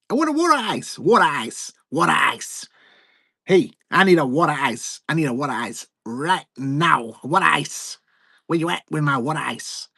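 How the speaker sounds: put-on Philadelphia accent begging for a water ice; mock Philly voice. Streamer doing a demanding Philly voice for a button.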